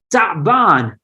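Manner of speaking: ta'ban said in Arabic with a long held t and open a sounds; Arabic word ta'ban. Shown as a demonstration. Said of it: "You can hear it".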